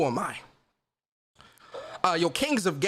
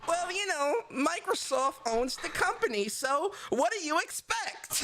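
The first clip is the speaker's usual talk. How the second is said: mocking Twitter commenter; 11 semitones higher, 5 dB quieter than usual. Streamer mocks a Twitter comment for a soundbite, high sneering voice. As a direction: ham up a smug know-it-all from Twitter. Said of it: "One more time for the sound".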